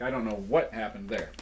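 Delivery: ordinary talk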